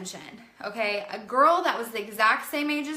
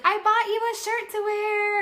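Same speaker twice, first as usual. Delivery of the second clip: mom's voice; 11 semitones higher, 4 dB louder than usual. Retold or imitated. Mom voiced in the story.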